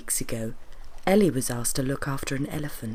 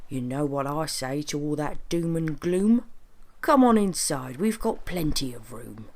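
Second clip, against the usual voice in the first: jolly magical train conductor. Acted. Train conductor invites worried friends inside, hearty and jolly. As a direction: wave away their worries and welcome them aboard.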